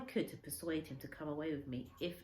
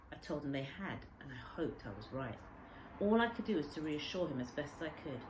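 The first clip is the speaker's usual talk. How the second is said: ordinary delivery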